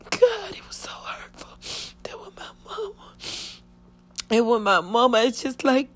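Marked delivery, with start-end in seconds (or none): 0.1-3.1 voiceless crying whisper, much softer; real tears, barely breathed out; whispered sob. Real, not acted. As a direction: let grief steal the voice to a breath. Weeping recalling mother's body.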